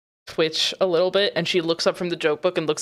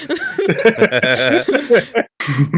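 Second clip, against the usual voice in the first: laughing; 2 semitones higher, 7 dB louder than usual. Real, not acted. Cracking up mid-scene.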